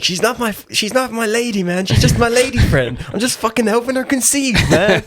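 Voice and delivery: high, excited stoner voice; Dude impression. Acting out the Dude's quote.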